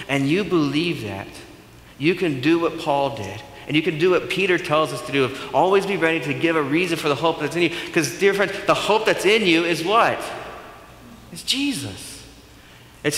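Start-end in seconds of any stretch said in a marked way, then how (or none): none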